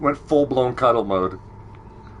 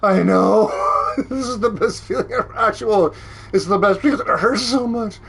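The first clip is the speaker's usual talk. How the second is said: laughing; 7 semitones higher than usual. Real, not acted. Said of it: "Oh, my face".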